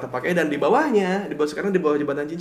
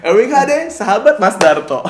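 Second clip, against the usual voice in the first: laughing loudly; 7 semitones higher, 8 dB louder than usual. Real, not acted. Joke name, laughing loudly.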